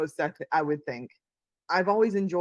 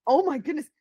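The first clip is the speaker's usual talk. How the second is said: scandalized; 8 semitones higher, 3 dB louder than usual. Retold or imitated. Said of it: "scandalous moment".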